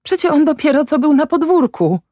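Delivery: very high, sustained protest; shouting. A narrator's reading. Son refuses his father's news, high protesting cry. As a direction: shout in denial, mostly through pitch. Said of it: "krzyczał Jędrek".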